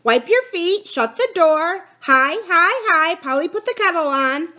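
high, loud parrot squawk; screeching parrot. A narrator's reading. Kiki the parrot yelling in the tunnels, screeching. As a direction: screech and yell like an excited parrot. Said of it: "she began to screech and yell".

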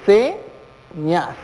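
"señas" split into se and ñas with a pause; syllable by syllable. Shown as a demonstration. Teacher separates the word for students, syllable by syllable. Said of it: "Separemos en sílabas la palabra señas".

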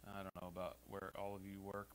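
ordinary talk, nothing marked

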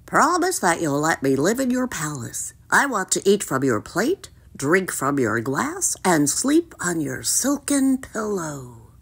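croaky frog listing demands; measured, froggy character voice; croaking frog, bargaining. Acted. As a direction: list your terms in a frog's croak.

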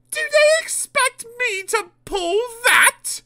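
very high, loud, slow outrage; indignant. A narrator's reading. Daisy seeing the van to be coupled, indignant. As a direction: protest in a high, affronted voice. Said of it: "she asked indignantly".

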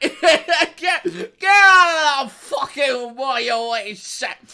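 slow, drawn-out Scottish roar, high and loud; over-the-top Scottish outrage; angry Scottish trainer. Acted. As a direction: stretch the words into a huge Scottish bellow. Repeats Scottish yell, more drawn out.

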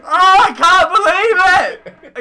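high, loud and slow, cracking up; real laughing exclamation; laughing disbelief. Real, not acted. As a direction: cry it out through laughter. Listener reacts to punchline.

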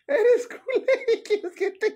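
high, slow laughing words. Real, not acted. Woman repeats the joke through laughter, laughing. Mocking phrase while laughing.

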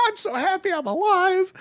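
very high, hammed-up happiness; mock joy. Imitating the hero glad to be alive, high mock joy. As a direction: squeal it as a mock-grateful survivor.

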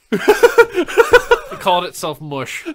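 high squeal of laughter; laughing. Real, not acted. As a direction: laugh the words out slowly. Laughing while speaking.

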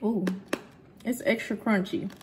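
normal talking voice

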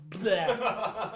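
loud laughing yuck; mock spitting bleh. Five-year-old spitting out a sausage roll, mock bleh. Child spits out food.

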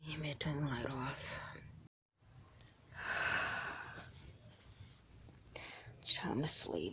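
soft whispered bedtime words; bedtime hush. Acted. Mom turning off the light, whispering so Heidi sleeps. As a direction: hush her gently to sleep. Mom tucking daughter in at night.

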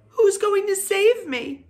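high, loud, drawn-out plea; cried out. A narrator's reading. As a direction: squeal the question urgently. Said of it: "cried Wilbur".

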